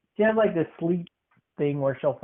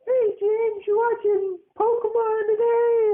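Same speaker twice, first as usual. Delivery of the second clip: very high old-lady falsetto imitating grandma; squeaky high grandma impression; slower than usual. Grandson imitating grandma calling him, in high falsetto. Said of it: "she'd come out and say".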